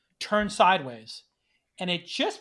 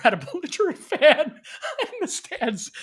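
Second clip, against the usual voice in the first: laughing; 11 semitones higher than usual. Real, not acted. Reading ESPN quote, cracking up.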